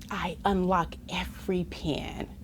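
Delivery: secretive whisper; hushed conspiratorial whisper. Acted. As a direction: breathe it like a secret plan. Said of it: "whispered line in read-aloud".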